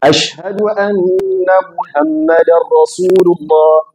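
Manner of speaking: أشهد أن محمدا رسول الله بنطق عربي فصيح; Arabic recitation. Shown as a demonstration. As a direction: clear Arabic "محمدا" with a strong throat ḥ. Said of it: "you say".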